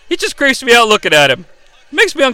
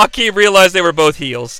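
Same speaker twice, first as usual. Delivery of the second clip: normal talking voice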